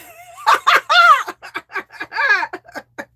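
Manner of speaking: loud, high, long laughter; real cackling laugh. Real, not acted. Laughing at viewer's psycho cheer.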